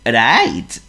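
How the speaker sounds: high, loud, slow seal voice; Honk the seal. Acted. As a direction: play a polite silly seal. Honk's voice.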